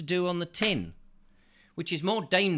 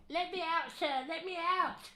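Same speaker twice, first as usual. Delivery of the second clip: tiny, very high, soft squeaky voice; Eric; 5 dB quieter than usual. Acted. Eric begs to be let out, tiny high pleading voice. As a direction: plead in a far-off little squeak. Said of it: "his opinion is".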